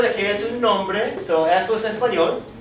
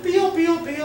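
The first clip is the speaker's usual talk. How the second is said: high peeping pío, pío; chick peep; 9 semitones higher, faster than usual. Shown as a demonstration. Teacher asking children if horses peep, high chick peep.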